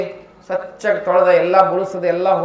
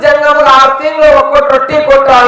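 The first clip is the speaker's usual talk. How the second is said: raised, louder pleading voice; roti pleading with lightning; 5 semitones higher, 4 dB louder than usual. Acted. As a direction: stand in the way and beg the lightning loudly. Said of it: "ಅಡ್ಡ ನಿಂತ ಹೇಳ್ತು".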